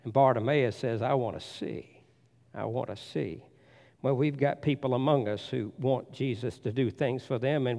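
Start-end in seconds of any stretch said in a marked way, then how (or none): 1.0-3.4 soft, low begging; a blind man's humble request; quiet plea. Retold or imitated.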